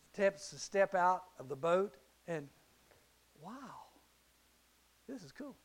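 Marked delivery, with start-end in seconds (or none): 3.4-5.6 hushed wonder. Acted. Peter stepping onto water.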